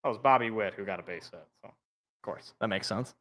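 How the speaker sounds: ordinary talk, nothing marked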